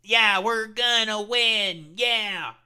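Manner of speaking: mock a hollow victory cheer; slow hammed-up yeah, we're gonna win; Chuck Schumer impression. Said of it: "Chuck Schumer meekly doing".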